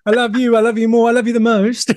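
high, loud sappy lovey voice; lovey-dovey voice. Retold or imitated. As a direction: coo it like a smitten couple. Mocking bromance lovey dialogue.

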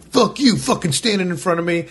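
high-pitched retold outburst; mock angry swearing. Big dude swearing at those standing, mock angry. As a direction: imitate a man cursing people in front of him. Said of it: "he's swearing at Rachel and I".

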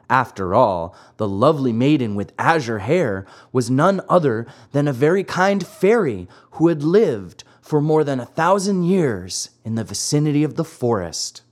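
ordinary delivery, nothing marked

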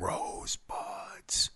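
very slow, drawn-out whisper; whispered like a mysterious last word; hushed whisper. Retold or imitated. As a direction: stretch it into a mock-dramatic whisper. Repeats the famous film whisper.

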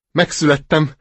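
glad cry. Acted. Duckling announcing its birth, cheerful cry. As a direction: call out happily, just hatched. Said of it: "kiáltotta vidáman".

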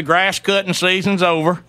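ordinary talk

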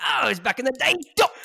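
high, loud fake Australian; exaggerated bad Aussie accent; terrible Australian accent. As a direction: ham up an Australian twang. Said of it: "it was a terrible Australian accent".

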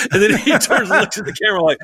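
laughing through the telling. Real, not acted. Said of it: "laughing while telling".

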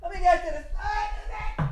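high slow silly voice. Acted. Sponsor segue voice.

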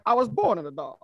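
villain voice, imitating Bane. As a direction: do Bane's villain line.

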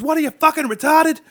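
high, loud exasperated yell at idiots; imitated angry yell. Comic loses patience with the crowd, angry high yell. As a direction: bark it like a comic fed up with hecklers. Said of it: "he finally had enough".

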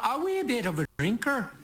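Irish lilt on "wee bit of a drinker", pitch lifted. Acted. Confessing his drinking in a light Irish accent. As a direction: slip into a light Irish brogue. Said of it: "I don't know why I went Irish there".

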